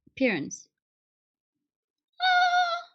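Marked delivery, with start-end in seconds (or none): 2.2-2.8 high squealing dismay; high shocked whimper. Real, not acted. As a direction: squeal at the sight of yourself. Filter makes her look awful.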